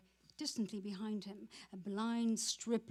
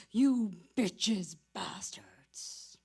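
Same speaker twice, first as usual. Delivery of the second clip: sour whisper; 8 dB louder, much slower than usual. Acted. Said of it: "he said sourly".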